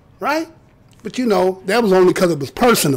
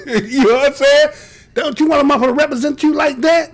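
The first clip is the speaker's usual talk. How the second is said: normal talking voice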